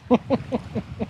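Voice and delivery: high, quiet staccato giggle; real giggle. Real, not acted. Commentator amused by Biden's joke, high staccato giggling. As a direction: giggle helplessly in short high bursts. Laughs at his quoted joke.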